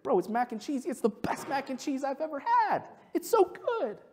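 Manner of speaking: hammed-up food praise; mock rave. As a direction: overdo the delight. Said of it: "You're like".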